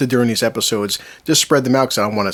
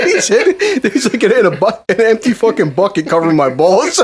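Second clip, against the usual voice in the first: high, laughing delivery of a punchline; cracking up; 9 semitones higher, 4 dB louder than usual. Real, not acted. Friend delivers Al's final absurd detail, cracking up. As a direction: barely get it out for laughing.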